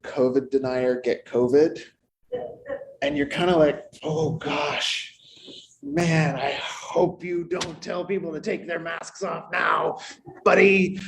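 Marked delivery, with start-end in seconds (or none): 4.0-11.0 higher, held-back gloating dressed as concern; mock concern. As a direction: feign sympathy while secretly feeling vindicated. Mock concern for COVID denier.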